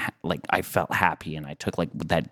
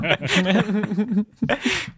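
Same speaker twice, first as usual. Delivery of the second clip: high laugh; 13 semitones higher than usual. Real, not acted. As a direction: burst out laughing.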